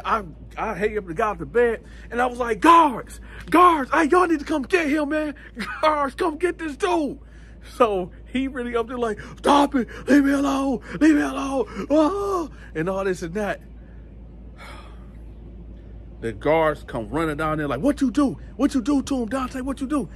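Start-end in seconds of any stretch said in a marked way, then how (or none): 2.6-7.2 high-pitched mock yell for help; imitated calling out. Prisoner calling guards to get his cellmate, high mock yelling. 9.4-12.5 high, mock nightmare cries; imitated hollering. Cellmate hollering in his nightmare, high-pitched mock cries. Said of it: "was up there hollering and screaming". 17.8-20.1 imitated guards. Guards come running, demanding answers.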